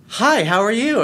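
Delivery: suddenly cheery, high-pitched hello; smiling phone greeting. Retold or imitated. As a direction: answer the phone with a big audible smile. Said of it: "he got a big smile on his face".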